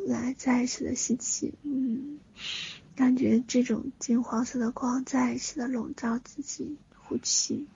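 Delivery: hushed calming breath guidance; guided meditation. Acted.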